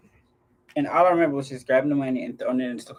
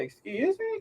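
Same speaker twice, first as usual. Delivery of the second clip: very high, soft mock disbelief; retold incredulous question; 8 dB quieter, slower than usual. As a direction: squeak it softly, acting out disbelief. Retold reply to money demand.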